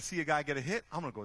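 ordinary talk, nothing marked

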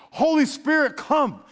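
high, loud cry of Holy Spirit, come; shouted prayer. Shown as a demonstration. Preacher showing the church how to mean the prayer, crying out. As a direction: call out to the Spirit in earnest. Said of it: "Mean it".

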